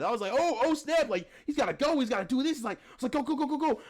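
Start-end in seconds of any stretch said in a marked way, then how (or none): 0.3-2.6 higher-pitched excited cheering-on; retold excitement. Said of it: "getting mad excited". 3.1-3.8 high, urgent egging-on; retold excited rooting. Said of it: "getting mad excited".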